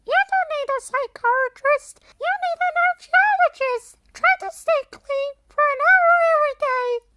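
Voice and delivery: put-on high, loud bossy girl; Lucy's voice. A narrator's reading. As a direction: lecture him in a high girl voice. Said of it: "Lucy tells him".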